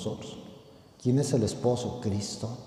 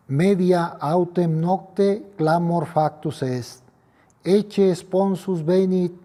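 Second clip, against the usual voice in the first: raised, solemn gospel proclamation; liturgical proclamation; 6 semitones higher, 5 dB louder than usual. Acted. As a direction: proclaim it like a priest at the altar. Latin gospel proclaimed at Mass.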